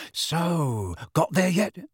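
mildly eager question. A narrator's reading. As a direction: lean in, hungry for news. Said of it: "said George eagerly".

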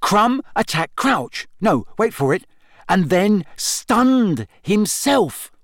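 high, drawn-out dramatic reveal; excited, suspenseful build. A narrator's reading. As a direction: lay out a theory slowly, stressing each key word high. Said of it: "said Ron excitedly".

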